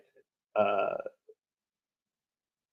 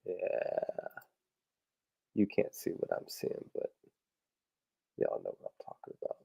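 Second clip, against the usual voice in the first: whispered aside; 15 dB quieter than usual. Real, not acted. Muttering while searching for image.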